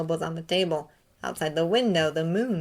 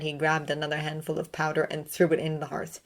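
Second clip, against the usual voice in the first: ordinary delivery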